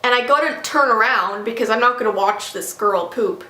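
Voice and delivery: ordinary delivery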